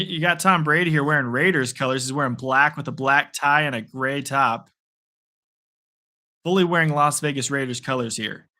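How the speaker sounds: usual speaking voice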